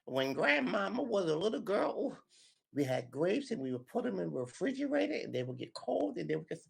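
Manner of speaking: put-on high, sweet old grandmother telling a story; old grandma voice. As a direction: coo it like a grandma to her little ones. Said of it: "you'll be telling your children".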